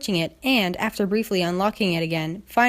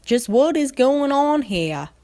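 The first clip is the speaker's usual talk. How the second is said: stern Applejack; 6 semitones higher, slower than usual. Acted. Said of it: "that Applejack, though".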